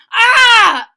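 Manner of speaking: very high, very loud startled scream. Acted. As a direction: shriek back in surprise. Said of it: "screamed Frank Junior, Denton, and Kate".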